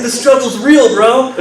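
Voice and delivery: high-pitched bro exclamation; mock amazement. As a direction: imitate an amazed guy, pitch jumping up. Acting the amazed phone caller.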